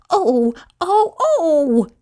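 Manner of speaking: high-pitched yelps of pain; pained yell. A narrator's reading. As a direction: yelp as if stung. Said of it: "yelled Rat".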